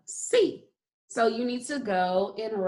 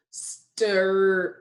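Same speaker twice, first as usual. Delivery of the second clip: slow sounding out. Shown as a demonstration. Teacher hunting the next sound, slowly stretched. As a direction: stretch "Stir" with a long final r. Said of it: "say the word slowly again".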